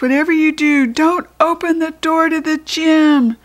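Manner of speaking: high, loud desperate warning; urgent warning. A narrator's reading. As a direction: beg them not to open it.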